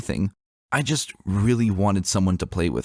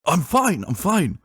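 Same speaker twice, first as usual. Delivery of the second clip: hasty reassurance; 10 semitones higher, slower than usual. A narrator's reading. Father stopping his daughter's spell, hurried raised reassurance. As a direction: quickly wave off the fuss, strained.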